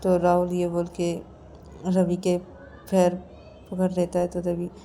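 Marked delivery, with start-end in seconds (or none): none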